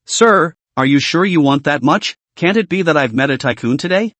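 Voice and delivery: plain reading voice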